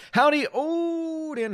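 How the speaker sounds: high, slow, drawn-out cowboy greeting; drawn-out howdy. Pretend. Reads chat greeting, drawn out.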